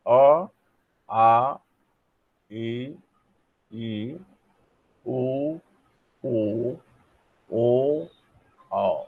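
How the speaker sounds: each vowel said alone, slow and clear; oral vowels demo. Shown as a demonstration. Teacher sounding out oral vowels one by one. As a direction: model the oral vowels for students. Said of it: "आप उच्चारण कीजिए और देखिए".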